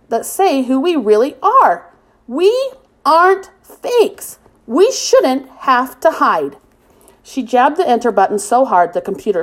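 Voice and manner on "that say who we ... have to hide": defiant, deliberate insistence; slow emphatic plea. Acted. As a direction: spell out each word slowly, much higher on fakes. Jen's passionate rally plea.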